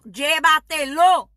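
loud, slow llévatelo, each syllable hammered; forceful command. Acted. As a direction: command them to take the child. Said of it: "urging parents to bring child".

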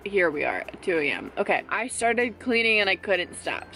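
normal talking voice

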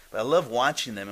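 ordinary talk, nothing marked

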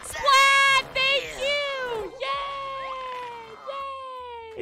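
very high cheer, loud then fading; high-pitched squeal of celebration; squealed thanks. Acted. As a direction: shriek the thanks and let the yay tail off. Celebrating a viewer's support.

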